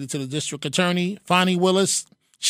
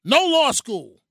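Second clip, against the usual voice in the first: mock boast; 7 semitones higher, slower than usual. Mocking boast.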